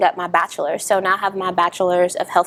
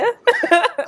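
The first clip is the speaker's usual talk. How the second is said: 1 high burst of laughter; 13 semitones higher than usual. Real, not acted. Laughing at her own joke.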